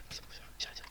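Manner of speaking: soufflé sans voix; chuchoté. Real, not acted. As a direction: se reprend tout bas. Se calme après l'excitation. (chuchotement pour se calmer)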